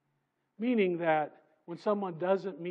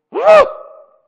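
loud high gasp of shock; 24 dB louder than usual. Acted. Preacher acting out the moment something drops, gasping. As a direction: gasp as if something just shattered.

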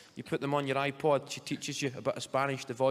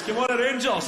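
high, loud football fan's yell; shouting; 9 semitones higher, 8 dB louder than usual. Retold or imitated. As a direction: bellow support as a passing fan. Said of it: "He shouts".

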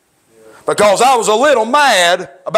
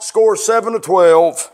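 ordinary talk, nothing marked

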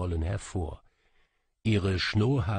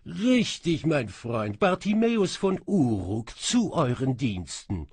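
laughing djinn; 5 semitones higher, 4 dB louder than usual. Acted. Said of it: "Lachend fuhr ich fort".

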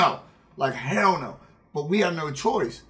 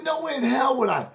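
indignant shout; 8 semitones higher than usual. Retold or imitated. Denying to his friends that he wanted to stay, outraged shout.

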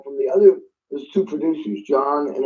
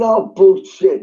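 a loud, slow scoff at the idea; loud, slow mock dismissal; 6 semitones higher, 7 dB louder, slower than usual. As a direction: brush the worry off hard, drawn out. Producer dismisses his worry.

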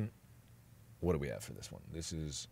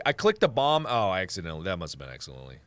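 ordinary talk

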